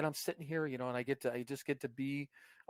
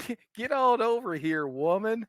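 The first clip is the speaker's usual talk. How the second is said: a louder, higher hillbilly holler; hillbilly accent; 8 semitones higher, 7 dB louder than usual. Pretend. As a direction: bark it like a backwoods Tennessee man calling a woman over. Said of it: "fucking hillbilly accent".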